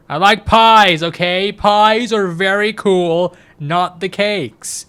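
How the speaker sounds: high, loud playful insistence; mock-indignant protest. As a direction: proclaim it like a silly objection. Mock protest over the word caked.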